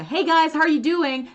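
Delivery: high, loud YouTuber-style greeting; imitating video hosts. Video host greeting viewers, imitated loud and high. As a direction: mimic an English video host hailing viewers. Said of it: "they were saying, like".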